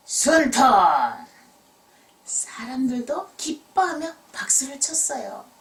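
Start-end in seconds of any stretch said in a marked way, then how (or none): 0.1-0.6 examiner cries out the result loudly; shouting. Acted. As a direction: call it out loud and proud. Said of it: "감독관이 외쳤어요".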